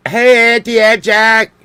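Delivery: loud, high, drawn-out hail; calling out. Real, not acted. Breaking off to hail Auntie Jack, calling out. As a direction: holler a stretched-out hello.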